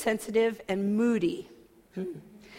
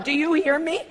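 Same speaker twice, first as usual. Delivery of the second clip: normal talking voice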